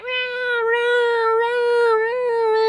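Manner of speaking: high, loud mock meowing; imitating cat meows. Streamer imitating her cat whinging for wet food, high mock meows. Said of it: "He was like".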